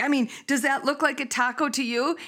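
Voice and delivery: mock yell setting the phrase straight; corrected shouted question. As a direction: shout the corrected wording, a bit flustered. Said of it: "he's like".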